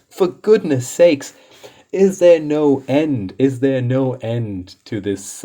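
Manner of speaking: high, drawn-out despairing plea; exasperated appeal for it to stop. Real, not acted. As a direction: wail it in exasperation, slowing right down. Exasperated at endless craziness.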